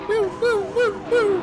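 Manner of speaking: high, loud whooping; excited whoops. Real, not acted. Cheering his own driving, high loud whoops.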